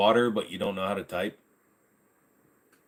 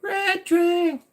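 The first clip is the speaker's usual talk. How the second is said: very high, louder squeal; squeaky shout; 5 dB louder than usual. Pretend. Streamer greeting viewer Red Drink, very high squeal. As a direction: yelp the name like a toon.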